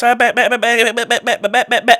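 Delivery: imitating a fast-talking coach; mock fast-talking Jimbo. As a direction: rattle it off like a jittery fast talker. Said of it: "talk fast".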